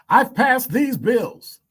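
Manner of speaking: high, slow, loud politician declaiming; fake politician voice. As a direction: proclaim it like a self-congratulating politician. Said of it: "that's my fake politician voice".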